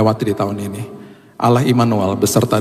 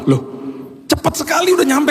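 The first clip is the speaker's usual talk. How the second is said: surprised. Retold or imitated. Driver arrives instantly.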